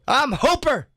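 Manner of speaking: high, loud movie-star growl; Burt Reynolds voice. Acted. Comedian keeps doing Burt Reynolds, pitched high. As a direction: announce it like the star. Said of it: "it sounded like him".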